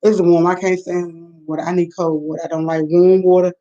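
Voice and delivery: usual speaking voice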